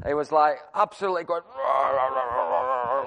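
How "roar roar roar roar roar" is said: high, rolling roar, over and over; imitated crazed roaring. Preacher showing what happened, mock crazed roaring. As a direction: mimic a man going crazy with roars. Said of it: "I'll show you what happened".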